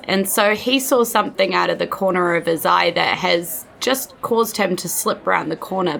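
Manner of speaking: usual speaking voice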